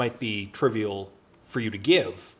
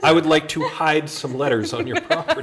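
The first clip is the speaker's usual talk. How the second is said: higher, louder prim letter voice through a laugh; mock formal letter; 6 semitones higher, 6 dB louder than usual. Asking a stranger's permission to hide letters, mock formal. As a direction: read it like a stuffy polite request.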